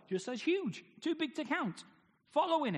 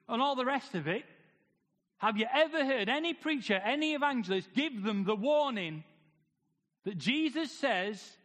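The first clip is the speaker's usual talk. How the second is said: usual speaking voice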